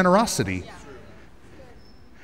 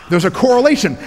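higher and louder, punching correlation; emphatic repeat; 6 semitones higher, 3 dB louder than usual. Shown as a demonstration. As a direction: hammer the word correlation home. Preacher repeats key phrase louder.

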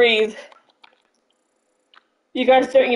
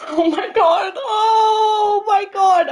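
high, slow, tearful gasp of joy; choked-up joy; 8 semitones higher, much slower than usual. Real, not acted. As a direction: hold back happy tears. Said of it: "I can't cry".

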